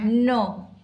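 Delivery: loud, emphatic no; firm refusal. Real, not acted. Tells the baby no. (kusog ug lig-on nga pagdili)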